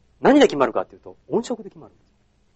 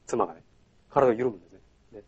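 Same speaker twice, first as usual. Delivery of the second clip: ordinary talk, nothing marked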